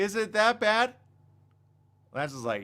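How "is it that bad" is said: high, loud disbelieving question; hammed-up incredulity; dismayed questioning. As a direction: plead for it not to be true. Mock dismay at bad film review.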